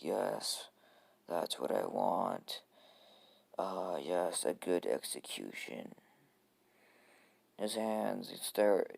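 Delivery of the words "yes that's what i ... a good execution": low, slow sinister relish; Gaara. Acted. Sand-wielding kid wants Naruto bowed for execution, low and sinister. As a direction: let it sink down, drawn out and creepy. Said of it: "Gaara's like".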